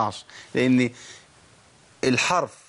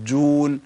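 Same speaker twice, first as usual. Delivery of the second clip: حاجون with the jeem pushed out loudly; wrong hard stress; 5 dB louder, slower than usual. Shown as a demonstration. Teacher mocking a forceful reading. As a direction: punch the doubled jeem in حاجون. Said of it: "ما ينفعش".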